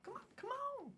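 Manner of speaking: high, pleading come on; egging someone on to move; impatient coaxing. Retold or imitated. Mimics man on camera.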